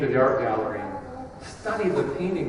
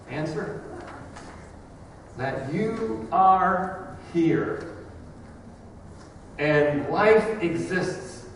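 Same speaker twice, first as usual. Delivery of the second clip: impassioned high lament easing into slow, spaced-out lines; poem recitation; 5 semitones higher, 3 dB louder, slower than usual. Acted. Preacher reciting Whitman's question and its answer, dramatic poetry recitation. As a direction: build the plea, pause, then answer slowly and deliberately.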